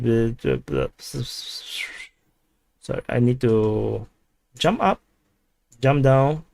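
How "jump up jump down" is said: ordinary talk, nothing marked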